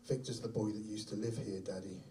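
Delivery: low, hushed creepy reveal; soft, low matter-of-fact chill; eerie child's answer. Retold or imitated. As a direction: deliver the ghostly punchline quietly. Son's eerie answer, story climax.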